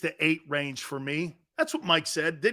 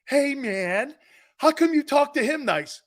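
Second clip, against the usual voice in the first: whiny, raised voice of a complaining listener; mock whiny caller; 8 semitones higher than usual. Host imitates listeners who complain he is nice to Golic, whiny. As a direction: needle him like a bitter fan.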